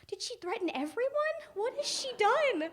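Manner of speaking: loud, very high disbelief; mock alarm. Girl wondering what big sister threatened, mock-alarmed high cry. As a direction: cry it out in hammed-up panic.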